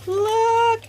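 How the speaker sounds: very high, loud cry of delight; excited shout. Real, not acted. Showing viewers a party lanyard, a high loud excited cry. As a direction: call it out thrilled.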